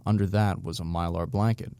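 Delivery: ordinary delivery, nothing marked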